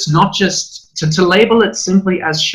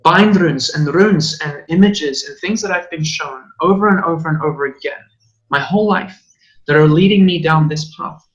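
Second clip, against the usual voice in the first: usual speaking voice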